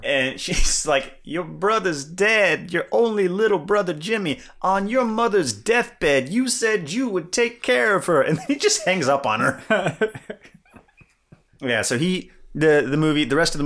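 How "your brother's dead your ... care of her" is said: high, slow nagging relative; nagging aunt. Retold or imitated. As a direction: nag him like an old aunt. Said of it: "nagging older relative who just won't shut up".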